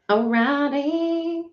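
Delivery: "Alrighty" drawn out slow and high; playful sing-song. Real, not acted. Streamer getting ready to start, high sing-song. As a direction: stretch "Alrighty" upward in a high lilt. Repeats the word, playfully stretched.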